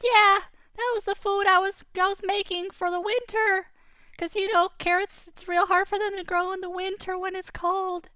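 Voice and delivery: a small rabbit upset about his carrots. Acted. Rabbit telling the boy about his carrots, squeaky and upset. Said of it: "He said".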